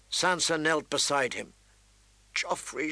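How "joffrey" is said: softer, tearful call; sobbing. Acted. Sansa kneeling by the wounded prince, softly tearful. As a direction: whisper his name through tears. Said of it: "she sobbed".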